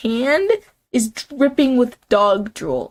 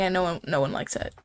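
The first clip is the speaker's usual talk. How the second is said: ordinary talk, nothing marked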